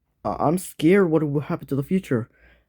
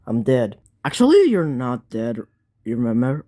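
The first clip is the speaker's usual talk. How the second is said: normal talking voice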